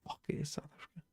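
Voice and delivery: hushed disbelieving whisper; whispered curse. Real, not acted. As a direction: breathe it out in a whisper. Realizes answer, swears quietly.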